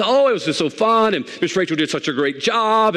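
mock gushing. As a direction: gush lightly, as if retelling the ladies' delight. Voices ladies gushing about their tea.

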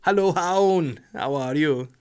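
mock Welsh greeting; playful Welsh. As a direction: greet someone in a jokey Welsh accent. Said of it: "if it backwards is Welsh".

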